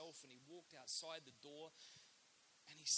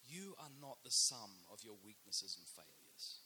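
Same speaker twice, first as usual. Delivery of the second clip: solemn quotation; 5 semitones lower than usual. Shown as a demonstration. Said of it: "said this".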